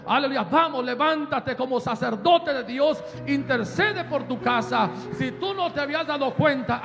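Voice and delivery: loud shouted sermon, building to a roar; fiery preaching. Acted. Pastor urging parents to fight for their children, shouted preaching. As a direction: preach at full force, rallying the congregation.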